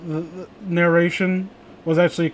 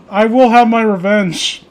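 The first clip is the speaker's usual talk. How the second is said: slow, louder, hammed-up threat; mock villain vow; 4 semitones higher, 6 dB louder, slower than usual. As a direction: swear revenge like a cartoon villain. Mock villain vow after teasing.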